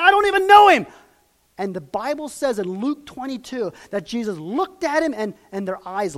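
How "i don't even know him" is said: high, loud retold cursing; cursing denial. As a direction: shout the denial like Peter. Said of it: "Peter's cursing and saying".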